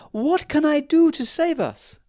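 the queen fearing they'll all be crushed; frightened cry. A narrator's reading. Fairy queen sees a Bigfoot coming: very high, loud frightened cry. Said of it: "cried the fairy queen".

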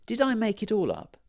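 put-on a small girl doubting herself aloud; wondering girl. A narrator's reading. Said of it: "said Millie".

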